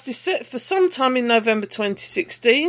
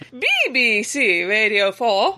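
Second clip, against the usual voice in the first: forced posh BBC announcer voice; slow, plummy radio announcer; 2 semitones lower than usual. Pretend. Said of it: "my posh voice was much less forced than that".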